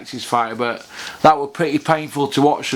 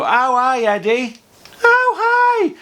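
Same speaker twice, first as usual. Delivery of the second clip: mock eager yes-man, much higher; eager lackey; 10 semitones higher, slower than usual. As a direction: squeak it like a fawning lackey. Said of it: "they go".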